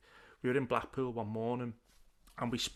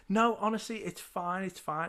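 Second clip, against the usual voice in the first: higher-pitched imitation of a woman reassuring fans; obliging celebrity; 7 semitones higher than usual. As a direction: mimic her brushing off the bother. Voices celebrity agreeing to photo.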